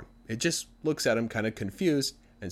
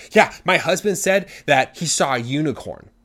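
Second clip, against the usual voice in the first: higher, louder, emphatic report; indignant tattling wife; 6 semitones higher, 9 dB louder than usual. Acted. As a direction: tell on your husband to the authorities. Wife eagerly reports on husband.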